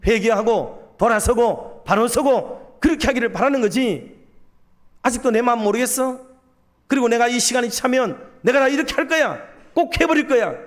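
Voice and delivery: high, insistent warning; acted divine anger. God warning the prophet that judgment will come, angrily. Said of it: "얼마나 화가 나는 줄 알아".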